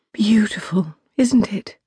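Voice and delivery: a hint of a gentle princess. Princess admiring the stars to Zoe, lightly voiced.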